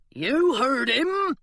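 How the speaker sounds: guard dismissing the crew; burly pirate. A narrator's reading. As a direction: send them off. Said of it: "said the burly pirate".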